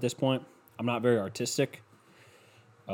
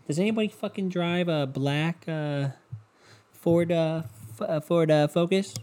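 lightly voiced lady, higher and halting; lady's voice; 5 semitones higher than usual. Lady asking the room about a car, lightly imitated. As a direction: pitch it up a little and hesitate between words. Voicing the lady coming inside.

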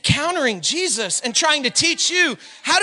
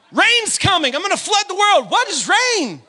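high-pitched announcement, then baffled question; hammed-up back-and-forth; lightly acted comic dialogue; 7 semitones higher than usual. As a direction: play both sides, puzzled at the end. Acts out God and Noah exchange.

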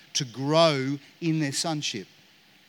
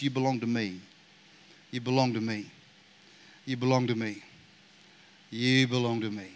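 tender fatherly voice; 6 semitones lower, 5 dB quieter than usual. Acted. Father's repeated reassurance to child.

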